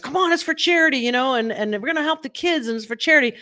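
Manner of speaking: high, pushy auctioneer coaxing; auctioneer pitch. Retold or imitated. Auctioneer urging the room to bid for charity, high coaxing voice. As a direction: egg the bidders on like an auctioneer. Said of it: "doing what he does best".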